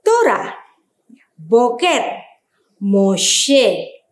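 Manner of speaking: Torah, Boker, Moshe each sounded out loud; over enunciating. Shown as a demonstration. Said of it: "contoh yang lainnya".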